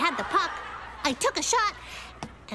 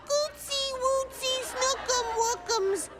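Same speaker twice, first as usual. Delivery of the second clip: put-on high, slow cooing baby talk; mock high sing-song baby talk; 9 semitones higher, slower than usual. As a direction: mimic a mother cooing to a baby. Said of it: "lean down into a baby carriage and say".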